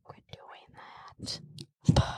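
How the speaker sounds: whispered scolding aside; hushed aside. Real, not acted. Mom quietly tells child to stop, whispered. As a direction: whisper it quickly off to the side.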